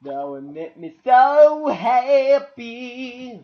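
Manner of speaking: mock pleading. Pleading with absent screwdriver.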